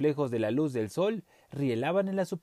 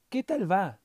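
put-on old bird voice; 6 semitones higher than usual. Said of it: "le preguntó una ave vieja".

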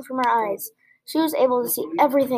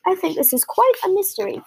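higher, bright chirp; 7 semitones higher than usual. A narrator's reading. Flap to the friends on the branch, chirping. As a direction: chirp it out lightly. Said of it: "Flap chirped".

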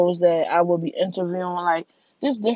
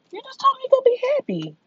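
high, fast mock whine; mock whiny squeak; 3 dB louder, faster than usual. Mimicking herself to people who wanted her happy, high fast whine. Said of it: "that shit really just be like".

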